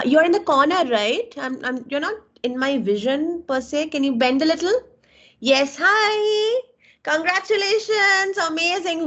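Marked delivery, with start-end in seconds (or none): 5.4-9.1 much higher, bright greeting; excited hello. Real, not acted. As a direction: beam at the student you finally see. Student finally visible on camera.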